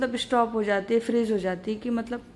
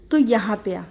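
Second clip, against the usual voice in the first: firm call; 8 dB louder than usual. Acted. Pooja summoning Rahul on phone.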